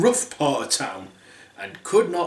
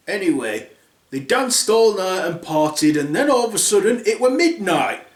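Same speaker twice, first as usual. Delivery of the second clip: usual speaking voice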